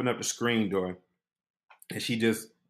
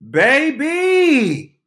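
much higher, louder joyful cry; excited calling; 10 dB louder, much slower than usual. Retold or imitated. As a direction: call out to your man, thrilled. Girl runs down calling.

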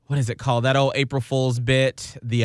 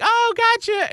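mock high gotcha. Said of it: "And it was like".

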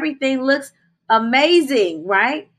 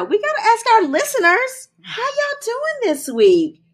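listener shout-out; 12 semitones higher than usual. Real, not acted. Host checking in with the podcast listeners, high sing-out greeting. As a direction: pitch it way up and stretch the question.

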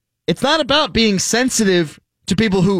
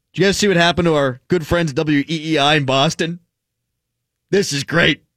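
usual speaking voice

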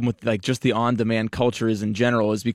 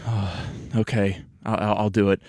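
soft, hesitant giving in; half-hearted, subdued consent; reluctant agreement; 3 semitones lower, 5 dB quieter than usual. Retold or imitated. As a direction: agree quietly, dragging out the okay. Reluctantly agreeing to wife's request.

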